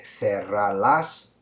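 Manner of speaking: "cerrarlas", "cerrarla", "cerrarlo", "cerrarlos" each drawn out; slow answer choices. Shown as a demonstration. Teacher reading options slowly to the class. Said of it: "would you say".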